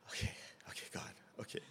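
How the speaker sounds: breathy whisper steeling himself; whisper to self. Retold or imitated. Bracing himself for the job, whispered resignation. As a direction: whisper it as a prayer.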